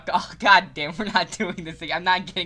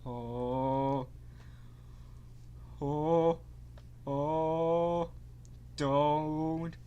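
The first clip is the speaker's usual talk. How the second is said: usual speaking voice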